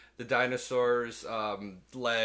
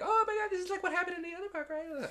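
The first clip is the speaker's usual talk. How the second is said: much higher, fast squeaky voice; mock panicked woman; faster than usual. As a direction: rattle it off in a girly panic. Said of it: "'cause she's like".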